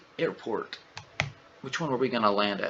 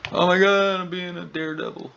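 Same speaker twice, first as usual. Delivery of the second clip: high, loud playful cry on OH MY GOD; mock daredevil alarm; 8 semitones higher, 6 dB louder than usual. Mock panic at a flight stunt.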